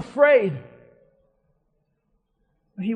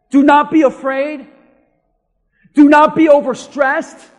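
loud, raised proclamation of scripture; forceful preaching; 4 semitones higher, 11 dB louder than usual. Acted. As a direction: proclaim it like a divine command. Preaches God's command forcefully.

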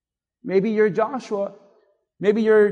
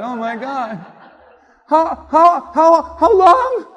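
distraught daughter; 7 semitones higher, 9 dB louder, slower than usual. Retold or imitated. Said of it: "and she's like".

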